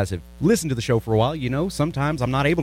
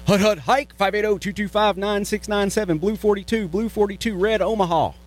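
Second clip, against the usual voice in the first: quarterback calling cadence; 8 semitones higher than usual. Pretend. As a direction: call it like a quarterback at the line. Host calls football cadence for number.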